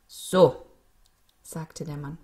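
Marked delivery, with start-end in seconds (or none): 0.1-0.7 brusque satisfaction. A narrator's reading. Gardener done with digging.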